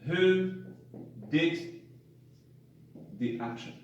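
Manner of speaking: the rule said word by word with long pauses; slow dictation while writing on the board; dictating so students can copy; dictation pace. Shown as a demonstration. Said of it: "write a very short summary here".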